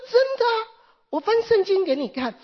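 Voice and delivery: put-on excitedly assuring the old woman it is true; eager insistence. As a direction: burst out with eager conviction. Pastor insists it's true.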